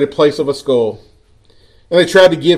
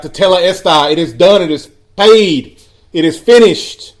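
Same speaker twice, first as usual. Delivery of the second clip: loud, raised, slow proclamation; crying out; 4 semitones higher, 6 dB louder than usual. A narrator's reading. Preacher voicing Jesus's final cry, crying out. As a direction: preach it out, each phrase landing hard. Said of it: "He cried out".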